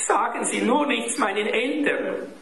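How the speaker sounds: put-on high-pitched pleading child; high, anxious cry at narrator volume; boy's crying-out plea. A narrator's reading. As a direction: beg like a boy who's been caught. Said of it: "schrie Klaus".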